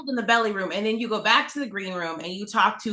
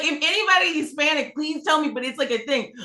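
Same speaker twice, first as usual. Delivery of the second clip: usual speaking voice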